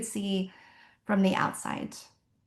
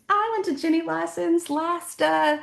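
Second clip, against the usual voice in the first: gushing fan post; 6 semitones higher than usual. Retold or imitated. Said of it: "post it and be like".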